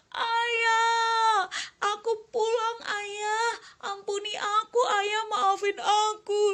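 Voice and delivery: high, loud tearful cry to father. Acted. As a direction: call out sobbing for forgiveness. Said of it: "Dan dia teriak".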